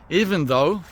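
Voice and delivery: usual speaking voice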